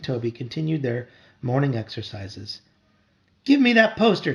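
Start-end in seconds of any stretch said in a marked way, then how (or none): 3.4-4.3 high, loud demand; a boy yelling at his brother; shouted. A narrator's reading. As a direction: grab for it with your voice. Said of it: "Toby shouted".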